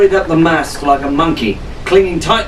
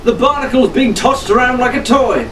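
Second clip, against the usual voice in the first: shouted; 8 semitones higher than usual. A narrator's reading. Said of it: "Fitzwilliam shouted".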